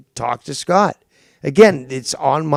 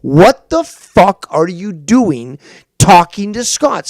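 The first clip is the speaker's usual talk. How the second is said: slower, louder, each word hammered; slow furious repeat; 2 semitones higher, 7 dB louder than usual. Real, not acted. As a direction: spell out the question in anger. Host repeats question, slower and louder.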